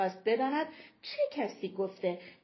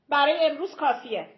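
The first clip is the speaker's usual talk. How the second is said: loud, raised, imperious order; commanding; 4 semitones higher, 7 dB louder than usual. A narrator's reading. Mama Elena ending the talk with Tita, commanding. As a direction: close the discussion with a firm command. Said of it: "با لحنی آمرانه گفت".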